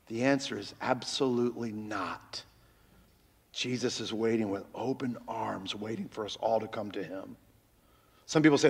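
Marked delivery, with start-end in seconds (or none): 0.1-7.3 soft, hushed, reassuring whisper; tender reassurance. Acted. Preacher softly assuring the congregation that God welcomes them. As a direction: gently lean in and comfort them.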